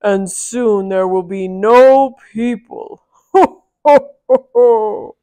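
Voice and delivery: booming loud giant with a falling end. Acted. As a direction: boom it out like a menacing giant. Giant gloats and laughs.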